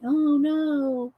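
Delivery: a hint of a whiny nerdy cousin; like cousin Gerard. Coloring host dreads a ruined page, lightly whiny Gerard voice. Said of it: "that just sounded like".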